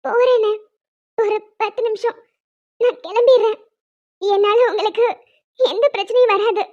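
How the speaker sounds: tearful, halting plea; crying. Acted. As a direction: plead through tears for a little time. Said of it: "ஏமா அழுகுற? அழாத, அழாம பேசு". (அழுதுகொண்டே கெஞ்சுவது)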